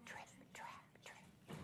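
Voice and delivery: whispered hoofsteps; children hear the smallest goat, whispered. Shown as a demonstration. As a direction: breathe it out like tiny hooves. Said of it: "Let's hear it".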